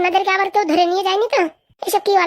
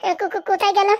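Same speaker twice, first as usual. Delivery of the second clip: higher-pitched, stuttering question; stammering; 5 semitones higher than usual. Acted. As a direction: trip over the first syllable before getting it out. Stammers while searching for missing friends.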